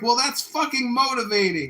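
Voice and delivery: high, loud acted declaration; emphatic sergeant praise. Drill sergeant praises recruit's effort, loud and emphatic. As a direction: proclaim it like an impressed sergeant.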